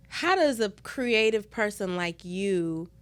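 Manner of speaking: ordinary talk, nothing marked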